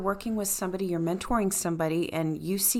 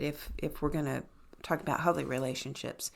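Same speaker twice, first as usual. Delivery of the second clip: ordinary delivery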